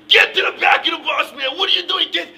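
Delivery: high, loud imitation of a Russian driver screaming; screaming Russian accent. Bus driver to student without a Metro card, screaming in a Russian accent. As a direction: yell it in a mock Russian accent. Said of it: "screaming at him with this Russian accent".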